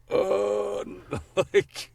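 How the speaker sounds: high, overwhelmed uh with a laugh; mock panic at a huge task list; overwhelmed quote. Telling friends about the AI's long list, high and overwhelmed. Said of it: "I'm like".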